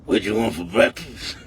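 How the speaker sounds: mock woman's voice dropped very low; deep gruff greatgrandma. Teasing the listener with a deep gruff old-lady voice. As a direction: growl it out like a bossy old grandma. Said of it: "aggressive tone of voice".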